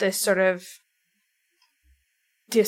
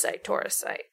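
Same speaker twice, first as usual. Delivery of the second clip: trailing off; 7 dB quieter than usual. Real, not acted. Listing endless tourist stops, fading to a whisper. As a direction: voice fades to a whisper on the last item.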